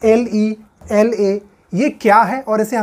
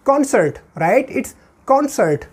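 usual speaking voice

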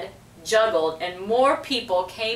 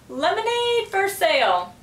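high squawking parrot call; 10 semitones higher than usual. A narrator's reading. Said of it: "Petey squawked".

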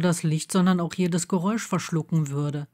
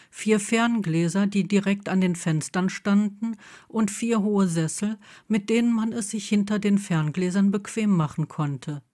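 ordinary delivery, nothing marked

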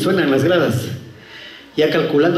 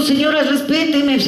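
high, offended mock plea; hammed-up wounded dignity; indignant protest; 8 semitones higher than usual. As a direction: protest the insult, pitched high. Acted tenant protesting insult.

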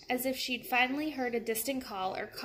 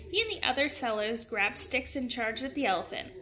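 ordinary delivery

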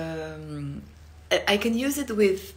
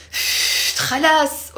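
slow, loud, higher "خلاص"; annoyed; 6 semitones higher, 6 dB louder, slower than usual. Shown as a demonstration. Teacher shows how she snaps "خلاص" when annoyed. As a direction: drag out a fed-up "خلاص". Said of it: "when I'm annoyed".

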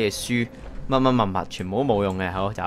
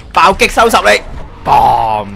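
battle shout; 4 semitones higher, 5 dB louder, slower than usual. Real, not acted. Attacking in the game.

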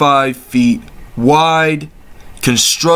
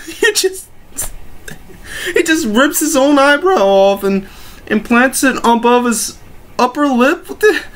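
incredulous laughing; 8 semitones higher than usual. Real, not acted. Describing the absurd picture.

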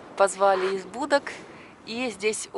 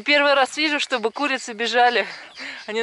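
ordinary talk, nothing marked

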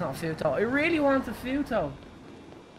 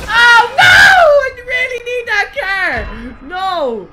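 high, loud wailing shout, drawn out slowly; anguished shout; 14 semitones higher, 15 dB louder, much slower than usual. Real, not acted. Streamer losing his prized car, wailing shout. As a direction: howl the loss at the screen.

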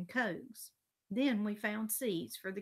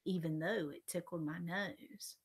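usual speaking voice

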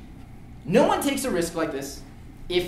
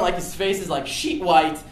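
ordinary talk

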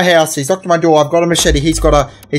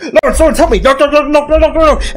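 high, frantic mock neighbor; hammed-up cry for help; panicked neighbor; 8 semitones higher than usual. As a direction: babble in a put-on panic, pitched up. Voices panicked neighbor in a story.

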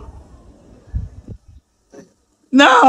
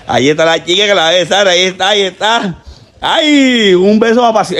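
usual speaking voice

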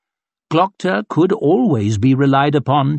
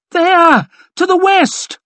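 very high, loud alarm cry; panicked shout; 6 dB louder, slower than usual. A narrator's reading. Quartermaster points to the enemy, panicked shout.

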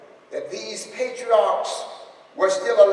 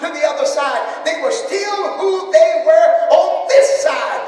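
loud, higher preacher's call, swelling on Abraham Isaac Jacob; preaching at full force; 5 semitones higher, 3 dB louder than usual. Real, not acted. Pastor assuring the grieving family, rousing preaching. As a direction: carry the church on each line.

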